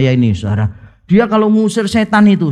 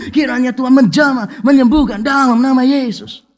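higher, rising into a full-voiced preacher's plea; grand fervent prayer; 4 semitones higher than usual. Shown as a demonstration. Preacher performing a lavish healing prayer for a sick child, with a rising fervent voice. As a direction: pray it big, building to a near shout at the end. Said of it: "Yang kedua".

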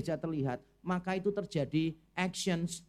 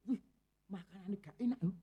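mengeluh; 6 dB quieter than usual. Retold or imitated. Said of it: "Ada orang tuh mengeluh".

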